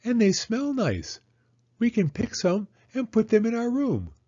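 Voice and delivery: put-on high child's voice, pleased; Penny telling her doll Rose, high and cheerful; little girl. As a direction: cheerfully repeat Mama's words as a small girl. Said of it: "said Penny".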